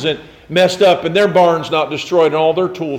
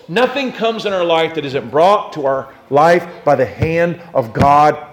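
ordinary talk

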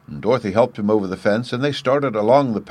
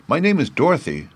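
lightly voiced young girl, pitched up; little girl; 7 semitones higher than usual. A narrator's reading. Dorothy introduces herself to the scarecrow, a higher girl's voice. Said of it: "said the girl".

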